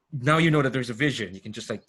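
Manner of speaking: ordinary talk, nothing marked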